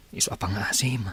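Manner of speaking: whispered plea. Acted. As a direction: whisper it, pleading softly. (naarasaas a pakaasi)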